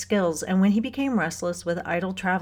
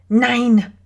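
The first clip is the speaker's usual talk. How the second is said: loud, higher German outburst; emphatic German objection, voice raised; emphatic German refusal; 5 semitones higher, 8 dB louder than usual. A narrator's reading. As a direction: forbid it sharply, in German. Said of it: "Her voice sputtered through three emphatic German sentences".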